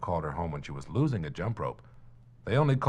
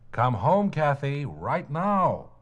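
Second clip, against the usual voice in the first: louder, firmer call home; 7 semitones higher, 7 dB louder, much slower than usual. Acted. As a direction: insist she come in now. Parents call again, firmer.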